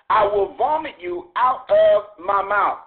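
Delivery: slow, heavy delivery of the warning; slow scripture quote. Acted. Preacher warning the lukewarm church, slow and heavy. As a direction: drag the words out with weight.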